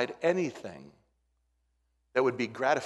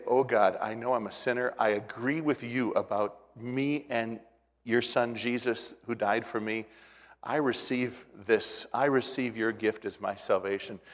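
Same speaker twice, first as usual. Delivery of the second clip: prayer; 3 semitones lower, 5 dB quieter than usual. Real, not acted. Pastor modelling a salvation prayer for listeners, soft. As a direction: pray it gently for them.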